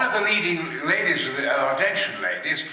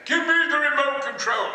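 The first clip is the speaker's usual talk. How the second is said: higher, booming villain demand; hammed-up villain line; 10 semitones higher than usual. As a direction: bark it like a pompous movie villain. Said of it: "and I".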